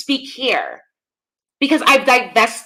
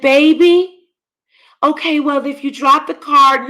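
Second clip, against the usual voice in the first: high, sugary mock-sweet mother voice; sarcastic impression of a sweet-talking mother; mocking fake soft voice; 4 semitones higher, 4 dB louder than usual. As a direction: imitate a fake soft-spoken mom. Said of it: "putting on that fake soft-ass voice".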